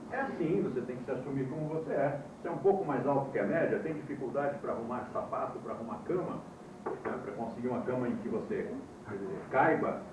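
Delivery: ordinary delivery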